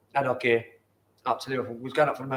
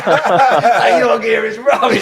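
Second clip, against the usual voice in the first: loud, high teasing through laughter; loud, high laughing jab at a mate's outfit; laughing tease; 8 semitones higher, 13 dB louder, much slower than usual. Retold or imitated. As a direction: rib him about his shirt while cracking up. Mocking teammate's clothes, laughing.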